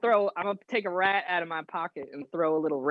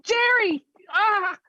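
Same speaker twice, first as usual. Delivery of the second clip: hammed-up cry of loss; anguished scream; slower than usual. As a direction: scream the name in mock grief. Pet rat killed, player cries out.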